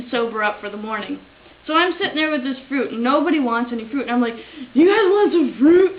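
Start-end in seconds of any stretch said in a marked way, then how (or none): 4.7-6.0 loud, high, eager offer; tipsy offer. Retold or imitated. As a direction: act out a wasted party guest offering around. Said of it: "'Cause I was, like, wasted".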